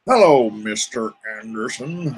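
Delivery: slow, cold, deliberate movie-villain line; mock sinister greeting to a viewer named Anderson. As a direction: savour each word like a menacing agent. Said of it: "I'm sure you never heard that growing up".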